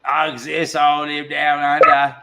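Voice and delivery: hammed-up Aussie accent; Australian accent. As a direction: say it like an Aussie Sasquatch. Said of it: "spoke with an Australian accent".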